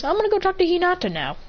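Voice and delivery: breezy, bright goodbye; lightly voiced cheerful character; cheery exit.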